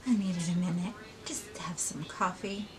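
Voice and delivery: soft whispered aside. Real, not acted. Pausing for coffee, a quiet whisper. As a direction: murmur it under your breath.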